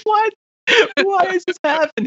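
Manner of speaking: hammed-up disbelief, voice jumping up; baffled. Retold baffled reaction.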